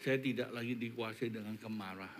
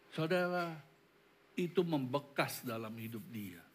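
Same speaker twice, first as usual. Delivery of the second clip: ordinary talk, nothing marked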